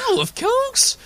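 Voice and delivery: swooping falsetto gush; gushing high. Acted. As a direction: gush it up high like a panto dame. Ugly sister gushing to the guards.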